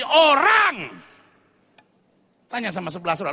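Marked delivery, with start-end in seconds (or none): 0.1-1.0 loud, high key word; punchy preaching cry; emphatic shout. Real, not acted. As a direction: hammer the word home to the congregation.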